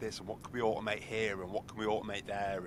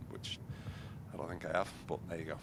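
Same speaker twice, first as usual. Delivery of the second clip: ordinary delivery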